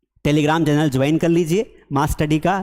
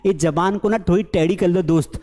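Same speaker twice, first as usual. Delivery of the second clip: ordinary talk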